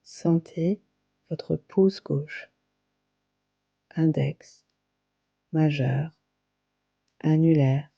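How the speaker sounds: calm, unhurried yoga nidra guidance with long pauses; guided meditation. Acted. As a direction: guide them gently into relaxation, phrase by phrase. (voix posée de méditation guidée, avec de longues pauses)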